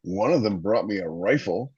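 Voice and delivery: usual speaking voice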